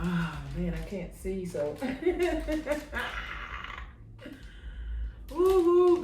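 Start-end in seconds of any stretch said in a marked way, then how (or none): 1.8-5.2 high airy giggle that fades out; breathy laugh. Real, not acted. Laughing at not seeing. 5.3-6.0 high, loud woo-hoo; excited whoop. Real, not acted. Celebrating while crafting.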